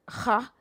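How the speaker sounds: "ha" with a rasped kh at the start; guttural K. Shown as a demonstration. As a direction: demonstrate "ha" from the back of the throat. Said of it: "the K is gonna sound".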